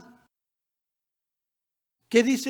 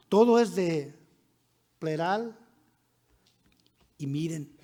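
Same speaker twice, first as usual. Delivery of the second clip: ordinary talk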